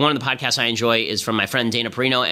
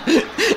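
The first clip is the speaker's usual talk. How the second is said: a real laugh bursting up high; high burst of laughter. Real, not acted.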